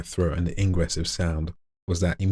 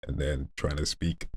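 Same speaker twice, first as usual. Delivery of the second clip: quiet lowered voice; lowered voice after inhale; 3 semitones lower, 12 dB quieter than usual. Shown as a demonstration. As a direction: speak low after breathing in. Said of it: "if I was to speak like that".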